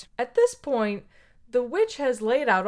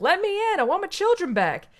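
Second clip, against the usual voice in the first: higher, a lightly voiced mother begging at the door; pleading mom; 9 semitones higher than usual. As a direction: plead to get her children back. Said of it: "and she's like".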